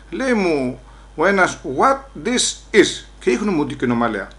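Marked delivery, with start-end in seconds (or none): 1.8-3.0 slow mock officer. Officer questions him in English.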